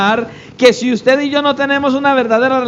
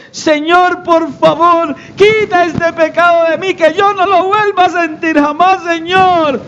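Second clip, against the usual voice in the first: high-pitched, overwrought plea to God; mock tearful begging; 7 semitones higher than usual. Believer prostrate before the Lord, hammed-up tearful begging. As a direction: beg as if bawling, hammed up. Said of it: "llorando a moco tendido".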